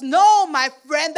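ordinary talk, nothing marked

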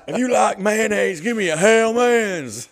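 mock commercial for Hellman's; hyped ad impression. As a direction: sell mayo like a shouting hype man. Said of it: "just gonna be impressions".

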